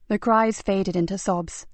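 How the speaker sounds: plain reading voice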